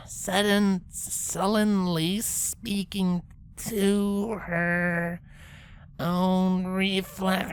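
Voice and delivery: slow halting reading. Acted. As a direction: struggle through the words. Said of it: "focusing as hard as she could".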